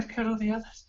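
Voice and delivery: high-pitched put-on voice of a scared elf; frightened elf, pitched well up; captive elf woman. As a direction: plead it high and hushed.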